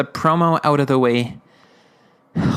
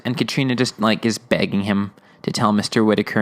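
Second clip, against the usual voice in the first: ordinary talk, nothing marked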